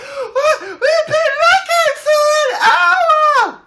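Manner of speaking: very high, loud relieved shriek; mock shrieking neighbour; neighbour overjoyed. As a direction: screech with wild relief. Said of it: "They're like".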